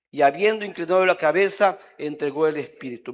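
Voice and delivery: scripture reading. Acted. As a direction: proclaim the verse with weight. Reads scripture aloud standing.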